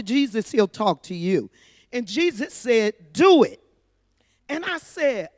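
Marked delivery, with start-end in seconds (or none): 3.1-3.6 a sudden loud, higher "Do it."; loud command. Retold or imitated. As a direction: give the order firmly, voice up. Jesus commands her in the story.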